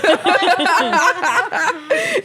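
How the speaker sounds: real helpless laughter at the memory; loud high laughter. Real, not acted. Teller cracking up.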